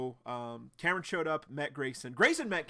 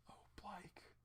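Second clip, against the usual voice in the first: whisper; 6 semitones higher, 21 dB quieter than usual. Real, not acted. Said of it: "reader pitying Blyke".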